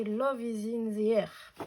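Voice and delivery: strong French accent on the English title. Real, not acted. Crafter reading a collection name to viewers, French-accented English. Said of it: "Désolée pour mon accent anglais".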